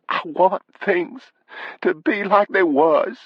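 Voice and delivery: high, slow, tearful plea; high-pitched wife trying to speak while crying. Acted. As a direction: plead through tears in a high drawn-out voice. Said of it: "then she did begin to cry".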